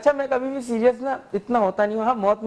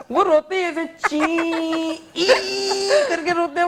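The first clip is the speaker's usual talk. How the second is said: mock crying squeal; 6 semitones higher than usual. Laughing at a mourner, imitating his squeaky crying. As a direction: mimic a silly high-pitched weeping noise. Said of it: "कुछ लोगों का रोना ना, रोना नहीं लगता".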